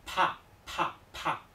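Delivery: PA released suddenly with lots of air; aspirated p. Shown as a demonstration. Teacher demonstrates the held-then-released breath, aspirated PA. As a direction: hold the air, then burst out PA. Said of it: "de repente lo suelto de golpe".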